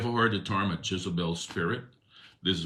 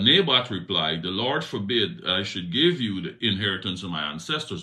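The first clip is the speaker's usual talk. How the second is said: louder Bible reading voice; scripture reading; 4 semitones higher, 7 dB louder than usual. A narrator's reading. Preacher reading Naboth's refusal to king Ahab, reading voice. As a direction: read Naboth's reply with weight.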